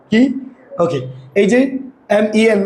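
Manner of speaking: ordinary talk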